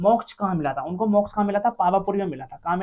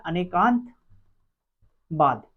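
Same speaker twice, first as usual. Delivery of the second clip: Anekantavada stretched out very slowly, syllable by syllable; slow drawn-out term; 2 semitones lower, much slower than usual. Shown as a demonstration. Teacher drilling the class on Anekantavada, each syllable drawn out slowly. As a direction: spell out Anekantavada slowly so students catch it. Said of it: "जिसका नाम क्या है?".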